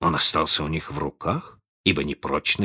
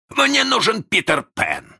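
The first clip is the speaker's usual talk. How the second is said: offended roar; 5 semitones higher, 3 dB louder than usual. A narrator's reading. Hook demands Peter Pan, roaring with grievance. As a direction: cry out for the enemy who wronged you. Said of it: "рявкнул с обидой Крюк".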